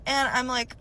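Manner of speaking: usual speaking voice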